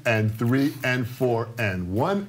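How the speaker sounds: counting the beat slowly; slow steady count. Shown as a demonstration. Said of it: "here's the tempo I want you all to do it".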